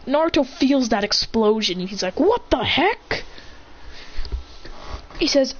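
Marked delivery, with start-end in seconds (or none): 2.2-3.2 high, drawn-out disbelief; bewildered. Acted. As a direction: stretch it out, baffled and pitched up. Naruto acted startled by explosion.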